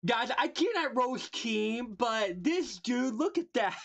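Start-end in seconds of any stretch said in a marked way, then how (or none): none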